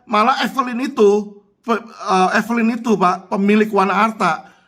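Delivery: ordinary delivery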